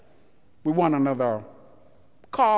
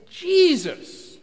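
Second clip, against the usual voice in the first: high, loud cry to Jesus; calling out; 13 semitones higher, 6 dB louder than usual. Acted. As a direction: call out desperately across the road. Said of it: "And he says".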